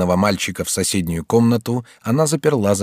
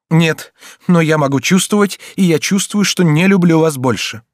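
high, raised, impassioned retort; hurt defiance; 7 semitones higher, 5 dB louder than usual. A narrator's reading. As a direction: declare it with wounded anger. Son's hurt, angry rejection. (с обидой и вызовом)

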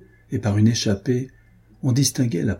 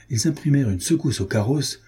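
ordinary delivery

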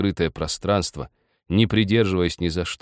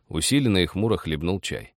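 ordinary delivery

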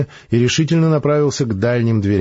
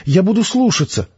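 put-on meek girl; 10 semitones higher than usual. Girl promising to obey.

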